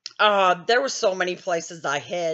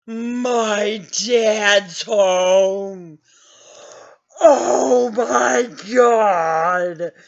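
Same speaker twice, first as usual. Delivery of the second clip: mock dread; 5 dB louder, much slower than usual. Dread on hearing dad is home.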